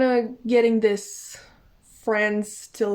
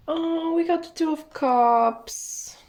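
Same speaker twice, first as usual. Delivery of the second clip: bright excited rise on the reveal; light up at a favourite card; a real little gasp of delight; 7 semitones higher than usual. Real, not acted.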